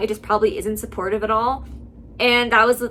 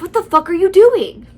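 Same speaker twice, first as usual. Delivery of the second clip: raised, loud outburst climbing in pitch; alarmed angry demand; 7 semitones higher, 9 dB louder than usual. A narrator's reading. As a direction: burst out, demanding an explanation. Acted husband confronting his wife.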